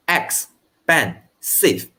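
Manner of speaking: Expensive said very slowly, syllable by syllable. Shown as a demonstration. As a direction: stretch out Expensive so each syllable is counted. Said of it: "it has how many syllables?".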